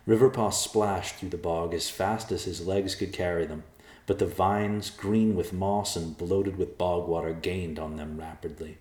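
ordinary delivery, nothing marked

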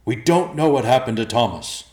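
loud, urgent warning shout; high, forceful shout; booming. Acted. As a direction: boom the warning down the hole.

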